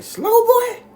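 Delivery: piercingly high, loud echo question; incredulous grandma. Retold or imitated. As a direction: mimic grandma screeching in disbelief. Grandma shocked by the name.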